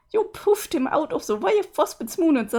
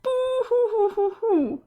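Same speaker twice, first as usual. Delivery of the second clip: mock crying along; 7 semitones higher than usual. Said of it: "You're going to make me cry too!".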